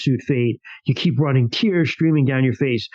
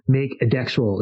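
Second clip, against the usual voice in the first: ordinary talk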